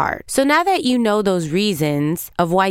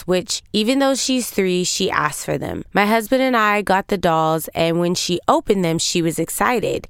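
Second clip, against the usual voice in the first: normal talking voice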